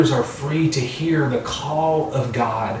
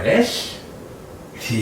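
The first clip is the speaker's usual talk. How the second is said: ordinary talk, nothing marked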